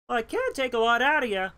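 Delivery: a hint of a Sonic Babies character; cartoon character line. Quoting a Sonic Babies cartoon, lightly voiced. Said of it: "That's from Sonic Babies".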